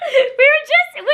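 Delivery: high laughter, the words spilling out through it; laughing. Real, not acted. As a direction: crack up at your own mix-up. Laughing at own muddle.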